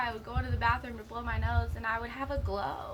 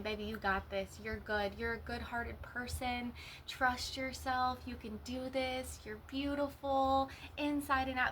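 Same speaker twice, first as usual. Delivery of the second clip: reassuring self-talk; 4 dB quieter than usual. Acted. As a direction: gently reassure yourself, taking your time. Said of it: "reassuring yourself".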